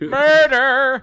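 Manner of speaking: high, very loud, drawn-out yell; mock dramatic shout. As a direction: bellow it like a comic alarm. Joking shout of an example.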